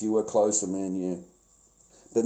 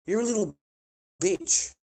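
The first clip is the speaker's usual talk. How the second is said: higher, sing-song jeer at the troll; mocking taunt; 9 semitones higher than usual. Real, not acted. Streamer taunting the troll who reported him, mocking jeer. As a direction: sneer it like a schoolyard insult.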